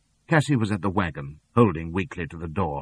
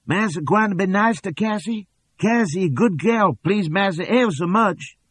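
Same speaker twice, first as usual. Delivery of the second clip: much higher, coaxing put-on girl's voice; fawning slave girl; 13 semitones higher, 5 dB louder than usual. A narrator's reading. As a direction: simper up high to please the master. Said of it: "and then Cassie's new voice".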